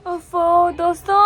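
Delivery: slow, drawn-out tired groan of a greeting; weary sigh. Real, not acted. Worn out after a day of errands, groaning to friends. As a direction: drag it out like you can barely stand.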